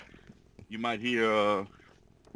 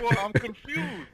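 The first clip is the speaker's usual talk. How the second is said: imitating Hector confused; 10 semitones higher, 3 dB louder than usual. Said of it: "He said".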